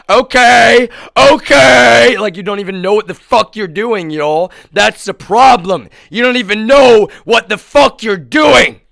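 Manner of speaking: scornful outburst; exasperated shout. Real, not acted. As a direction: yell it in disbelief. Angry rant at untrained operators.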